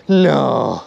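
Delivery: ordinary delivery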